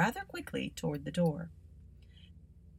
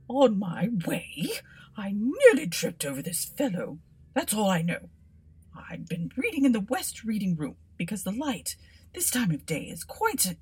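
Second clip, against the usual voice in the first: flustered scholar; 4 semitones higher, 4 dB louder than usual. Acted. Bobbins rambles on.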